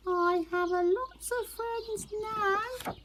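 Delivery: high distant echo of the call; echo answering from far. Acted. The echo answering Little Beaver from across the pond, distant high voice. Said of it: "a voice answered him".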